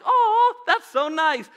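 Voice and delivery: high, loud gushing oh; mock sweet coo. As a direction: gush over something cute.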